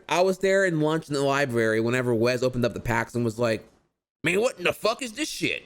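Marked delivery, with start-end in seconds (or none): 4.2-5.6 a loose, half-done imitation of Wesley's accent, pitched higher. Big friend cursing at rigged packs, half-done accent imitation. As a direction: fumble at his accent, higher than your own voice. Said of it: "I can't do his accent very well".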